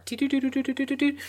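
high, soft, even run of "do"s; imitated electronic beeping. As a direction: beep rapidly on one high note. Imitates a device's noise.

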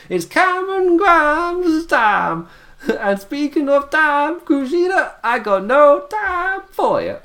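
high, slow cartoon wrestler voice, hammed way up; Cameron Grimes impression. Mimicking the wrestler's promo line, high and slow cartoon voice. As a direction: drag out each word in a squeaky cartoon villain voice. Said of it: "he said it just like that".

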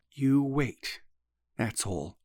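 slow, soft warning; measured, quiet threat with a pause; slowly. A narrator's reading. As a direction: say it slowly, letting the voice drop away. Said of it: "he said slowly".

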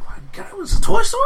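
voce alta e incredula, spinta in su; shocked disbelief. Retold or imitated. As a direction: lascia uscire lo stupore di colpo. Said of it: "I was like".

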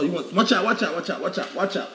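ordinary talk, nothing marked

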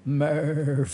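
hammed-up movie-hero call; Matthew McConaughey. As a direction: stretch the name out in mock drama. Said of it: "Interstellar is one of my favorite movies".

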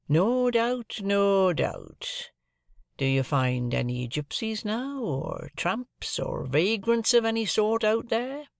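lightly voiced stranger, eager start; a hint of cunning curiosity; sly stranger. Stranger asks about tramps.

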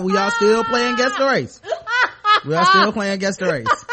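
loud, high, breaking into laughter; barely holding back real laughter; laughing. Real, not acted. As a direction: crack up while insisting on it. Host cracking up while reminding the show.

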